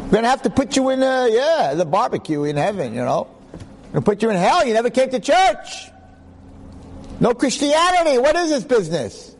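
high-pitched teasing judge; mock Jesus scolding. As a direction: scold him like a disappointed deity. Jesus voice continues the judgment.

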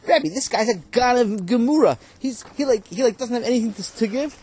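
high, loud exasperation; student complaining to the rabbi, high and loud; indignant. Real, not acted. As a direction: protest in disbelief. Student protests loudly.

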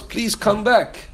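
slow, high, tender pleading; gentle plea. Acted. God pleading with Cain to return, slow and tender.

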